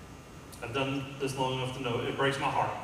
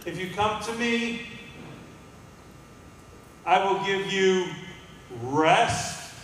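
high, slow, louder promise; voicing Jesus; 6 semitones higher, 4 dB louder, slower than usual. Preacher voicing Jesus promising rest, high and slow. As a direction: lift the promise up slowly. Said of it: "He says".

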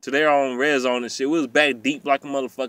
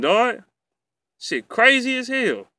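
usual speaking voice

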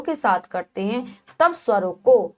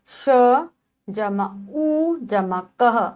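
slow spelling; much slower than usual. Shown as a demonstration. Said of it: "teacher spells word parts".

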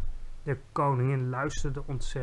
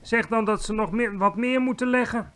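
higher, insistent command; imperious order; 6 semitones higher, 4 dB louder than usual. A narrator's reading. Queen wants more eggs laid, ordering imperiously. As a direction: give the order briskly from on high.

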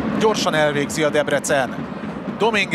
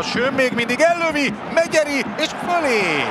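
excited hungarian sports commentary; 8 semitones higher than usual. Acted. Live commentary, shot over the bar.

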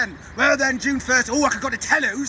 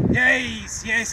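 ordinary talk, nothing marked

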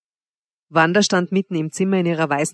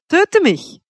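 much higher, louder daring cry; calling out; 11 semitones higher, 5 dB louder than usual. A narrator's reading. Wanda dares her lover to strike, crying out. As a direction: fling out the challenge. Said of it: "rief sie".

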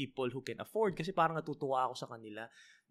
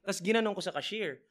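ordinary talk

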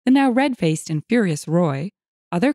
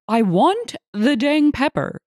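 slow, higher-pitched indignant demand; angry demand; 6 semitones higher, slower than usual. A narrator's reading. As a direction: hammer out each word, fed up. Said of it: "he shouted".

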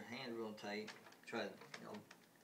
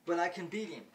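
louder and higher, cocky; boastful challenge; 6 semitones higher, 8 dB louder than usual. Retold or imitated. Alien boy boasts he can beat Will, loud and high. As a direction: step forward and boast. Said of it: "he steps out and says".

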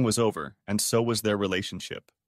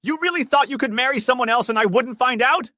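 high, loud accusing voice; angry; 14 semitones higher, 5 dB louder than usual. A narrator's reading. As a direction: confront him, furious. Jilted ex confronts groom.

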